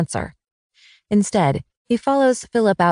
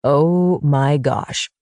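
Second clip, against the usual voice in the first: slow, drawn-out stunned realization; lightly voiced husband, amazed; 3 semitones lower, much slower than usual. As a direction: let it dawn on him slowly. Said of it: "his eyes huge".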